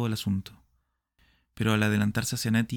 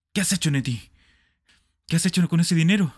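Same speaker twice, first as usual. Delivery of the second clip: louder, higher accusing question; angry demand; 7 semitones higher, 6 dB louder than usual. Acted. Smith rounds on Nettie over the money, angry and loud. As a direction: demand it of her hotly.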